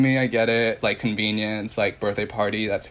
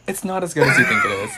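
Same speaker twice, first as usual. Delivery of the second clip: raised, insistent voice; 8 semitones higher than usual. Real, not acted. Heated food argument among friends.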